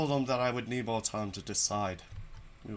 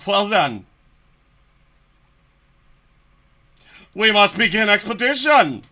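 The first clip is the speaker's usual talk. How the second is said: rousing call; 7 semitones higher, 12 dB louder than usual. Acted. Rousing start of expedition.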